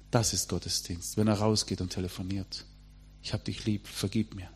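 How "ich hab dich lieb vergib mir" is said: quiet plea. Retold or imitated. Son's quiet plea in prayer.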